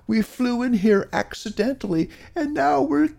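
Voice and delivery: very high, squeaky germ voice; crying germs. A narrator's reading. Cold germs tell Micro Pig they're trapped, tearful high squeak. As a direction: plead in a tiny, high-pitched squeal. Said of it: "they cried when they saw Micro Pig".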